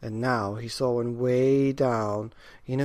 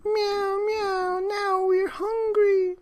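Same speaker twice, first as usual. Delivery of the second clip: very high, slow mewing cat chorus; hungry cats; 6 dB louder, much slower than usual. A narrator's reading. Hungry cats complain to the old man, high mewing. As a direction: whine like a crowd of hungry cats. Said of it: "said the hundreds of cats".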